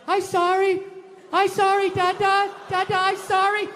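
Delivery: put-on high, pleading little-girl voice; crying toddler. Daughter apologizing to her dad, tearful and high. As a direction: beg Dada through tears. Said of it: "with big tears in her eyes".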